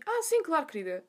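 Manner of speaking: high-pitched gushy reply, dropping at the end; mock sweet receptionist. Receptionist helping a first-timer, mock sweet. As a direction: lay on friendly helpfulness in imitation.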